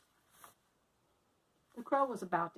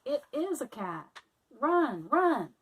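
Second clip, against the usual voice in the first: loud, higher run-for-it cry; panicked shout; 4 semitones higher, 7 dB louder, much slower than usual. Acted. Melissa warning Annie of the cat, panicked shout. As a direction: scream at your friend to flee.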